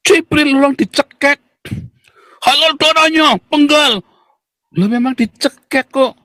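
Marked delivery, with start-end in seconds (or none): 0.1-4.0 high, exaggerated squawk of offence; mock outraged zealot. Opponents threaten him over his joke, high mock outrage. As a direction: parody the exchange in a high, strained voice.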